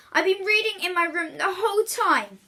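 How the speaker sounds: put-on high, loud protesting boy; pleading boy. William protesting to Mother, high and loud. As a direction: insist you did nothing.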